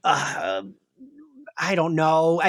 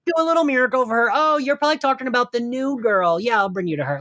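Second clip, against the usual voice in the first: lightly voiced chill kid, quick and higher; 6 semitones higher, faster than usual. Shade kid casually telling visitors about little miracles, breezily chill. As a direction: imitate an unbothered kid rattling it off. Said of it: "He's very chill".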